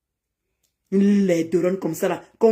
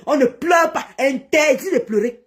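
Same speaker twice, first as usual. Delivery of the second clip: mocking raised shout; taunting shout; 3 semitones higher, slower than usual. Real, not acted. Taunting rival.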